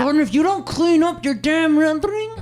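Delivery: high, loud mock scolding; hammed-up angry mom; mom yelling. Mom scolding Jordan before phone call, loud mock yell.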